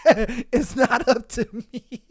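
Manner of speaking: slow words breaking up with laughter; laughing. Real, not acted. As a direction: try to say it while cracking up. Cracking up at own joke.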